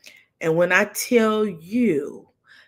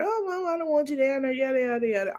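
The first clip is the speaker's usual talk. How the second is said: higher, dismissive mock daughter; whiny daughter; 7 semitones higher, faster than usual. Daughter telling mom to stay away, higher and dismissive. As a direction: brush mom off like a teen. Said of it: "my daughter".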